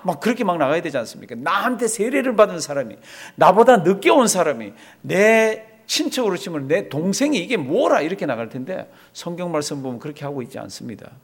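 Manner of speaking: ordinary talk, nothing marked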